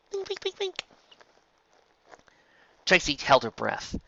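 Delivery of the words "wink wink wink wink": very high, soft teasing; sing-song sweet hint; high soft coaxing wink wink; playful wink. A narrator's reading. Bon Bon coaxing.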